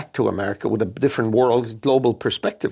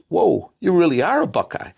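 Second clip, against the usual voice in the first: impressed interviewer; 7 semitones higher, 3 dB louder than usual. Retold or imitated. Interviewer sees the buckeye.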